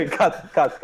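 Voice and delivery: laughing while calling cut. Real, not acted. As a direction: giggle through the words. Laughing, asking to cut clip.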